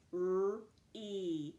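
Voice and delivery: lone R sound, then a long EE. Shown as a demonstration. Teacher tackling the first syllable, sounded out. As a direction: split RE into R and EE. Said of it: "First sound we hear is er".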